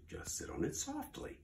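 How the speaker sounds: soft whispered urging; whispered coaxing. Acted. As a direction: hush it like a secret. Maisie coaxes gently.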